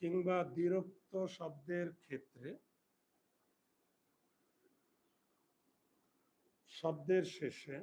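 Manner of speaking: slow, word by word for pupils to write; teacher dictating a rule, slow and spaced; slow dictation. Shown as a demonstration. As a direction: dictate it slowly with pauses.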